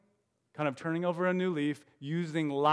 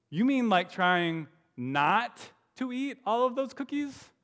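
higher, curious Toad voice; Toad's voice; 7 semitones higher than usual. Acted. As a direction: Toad asking innocently.

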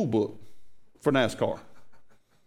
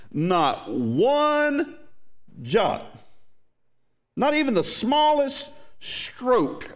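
preaching the verse; 4 semitones higher than usual. Acted. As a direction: drive each phrase home to the church. Rereads scripture with more force.

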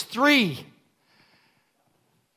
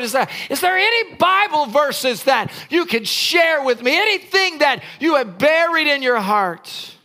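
raised, shouting pulpit questions; impassioned preaching; 3 semitones higher than usual. Acted. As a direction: fire questions at the church, full voice. Preaching at full force.

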